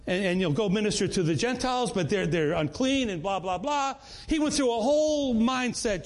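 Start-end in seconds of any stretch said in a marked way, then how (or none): none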